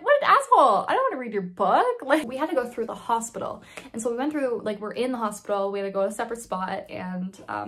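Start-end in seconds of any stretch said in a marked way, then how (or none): 0.0-2.0 high, loud mock outrage; indignant outburst. Teen reacting to a doctor's book plug, indignant. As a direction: blurt it out, indignant and incredulous.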